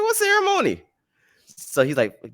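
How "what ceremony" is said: shot way up high in disbelief; a squeaky, baffled question; surprised. Retold or imitated. Asking the guru in high-pitched disbelief. Guru invites him to ceremony.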